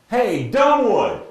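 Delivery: high, loud, drawn-out heckle from the booth; Split yelling. Retold or imitated. Broadcaster heckling the umpire from the booth, yelling. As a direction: holler the name at full force, stretched out. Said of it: "he starts yelling".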